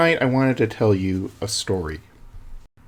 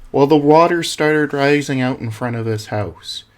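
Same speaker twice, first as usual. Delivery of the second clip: ordinary talk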